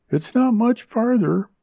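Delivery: high, cheery woman's voice; cheerful reassurance in a high character voice. A narrator's reading. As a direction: sing out cheerfully, pitched high. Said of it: "Junella said cheerfully".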